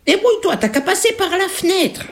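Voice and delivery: high-pitched impatient granny; fake grandmother. Ogress as grandmother sending girl away, high granny voice. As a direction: fob the child off in granny voice.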